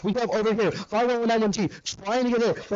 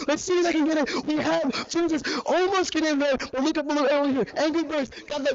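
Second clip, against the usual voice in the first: higher, fast live commentary; excited play-by-play; 6 semitones higher than usual. Real, not acted. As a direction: call the action as it happens.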